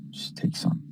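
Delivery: soft, breathy secret whisper; whispered coaxing. Acted. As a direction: lean in and tempt him quietly. Fake butler coaxes Batman with popcorn.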